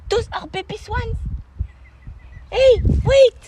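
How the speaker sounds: high, cooing voice for animals; talking to animals. Real, not acted. Him greeting swans and their babies, high excited cooing. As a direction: coo and call to them excitedly, up high.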